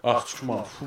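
usual speaking voice

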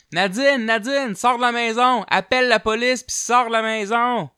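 high, loud yelled warning; panicked yelling across the hall; urgent shout. Retold or imitated. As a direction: shout her out of the house. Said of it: "Claude qui crie".

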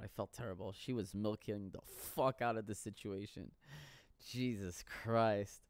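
ordinary talk

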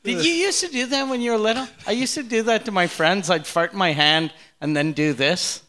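ordinary talk, nothing marked

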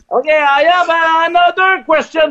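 nervous radio guy fumbling in English; awkward English on air. Retold or imitated. On air, a French radio guy nervously introduces himself in English. Retold eager English question.

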